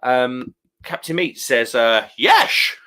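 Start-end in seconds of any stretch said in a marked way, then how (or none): 2.2-2.8 a jokey Dutch slur, pitched high; a hammed-up Dutch exclamation; mock Dutch accent. Reading a viewer's chat in a mock Dutch accent. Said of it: "He's, he's gone all Dutch".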